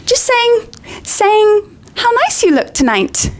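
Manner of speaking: high, squeaky Hermione covering up; forced high cover. Acted. Hermione covers herself to Myrtle, squeaky.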